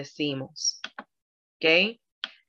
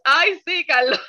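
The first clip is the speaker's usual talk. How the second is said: laughing; 7 semitones higher, 4 dB louder than usual. Real, not acted. Laughing with Carlos.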